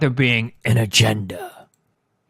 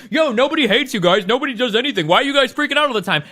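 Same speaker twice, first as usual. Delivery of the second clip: higher-pitched mocking quote of internet commenters; mockingly pitched-up "yo, guys" voice; mock casual protest; 8 semitones higher than usual. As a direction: imitate a dismissive online crowd, pitched up.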